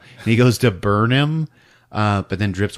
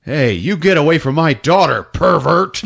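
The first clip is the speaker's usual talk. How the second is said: louder, higher mock angry dad; protective father; 8 semitones higher, 6 dB louder than usual. Father ordering Ralph away from his daughter, mock angry. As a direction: snap at him like a protective dad. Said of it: "David says".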